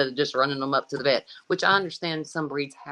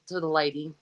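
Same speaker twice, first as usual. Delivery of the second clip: ordinary talk, nothing marked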